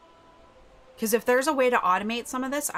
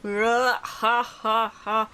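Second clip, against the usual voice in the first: imitated iconic laugh; much slower than usual. Streamer shows viewers the iconic scene laugh, forced and staged. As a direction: deliberately fake laugh in separate beats. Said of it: "ten iconic scene laugh".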